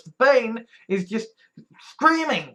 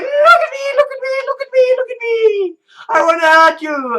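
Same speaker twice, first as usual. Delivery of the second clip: mocking high loud whine of trolls; screaming trolls; 9 dB louder than usual. Trolls screaming at her for attention, high mocking shriek. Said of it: "they're screaming their little heads off".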